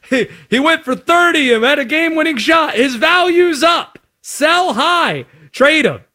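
high, giddy sell-now voice; mock gleeful trade reaction. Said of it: "my initial reaction was".

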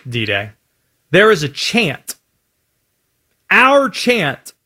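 ordinary talk, nothing marked

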